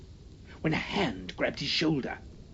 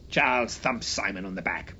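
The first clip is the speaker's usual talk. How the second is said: plain reading voice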